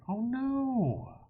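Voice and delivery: ordinary delivery, nothing marked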